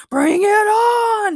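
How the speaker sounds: high, loud drawn-out bellow; ear-splitting battle cry. A narrator's reading. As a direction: roar the challenge, stretching the end. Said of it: "he bellowed".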